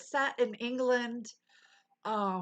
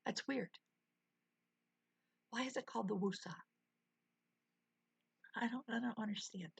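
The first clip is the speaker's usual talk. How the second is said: fast, soft whispered bafflement; baffled whisper; 9 dB quieter, faster than usual. Real, not acted. Streamer whispering her confusion to chat. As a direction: hush it out, puzzled. Baffled by strip club name.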